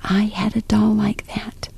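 faint whisper right into the ear; very soft secret whisper; tiny whisper. Acted. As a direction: breathe it so only she hears. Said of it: "she heard a tiny whisper in her ear".

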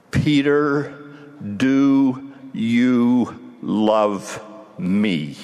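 very slow, every word weighed; the Savior asks Peter a third time, slow and probing; slow searching question. Acted. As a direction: press him slowly, word by word. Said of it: "but with relentless scrutiny".

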